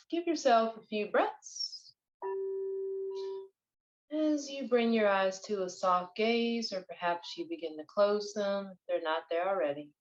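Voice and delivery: unhurried, even-toned breath coaching; guided meditation. Acted. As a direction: guide listeners through breathing, drawing out key words.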